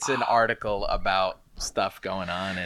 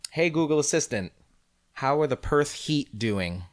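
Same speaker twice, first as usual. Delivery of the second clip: assistant command; 7 semitones higher than usual. Shown as a demonstration. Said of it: "let's ask her something else".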